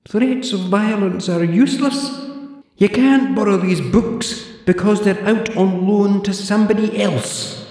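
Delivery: put-on high, prim old librarian voice; haughty zombie librarian, clipped and proper; librarian Deirdre. Deirdre lectures the borrower in a high prim voice.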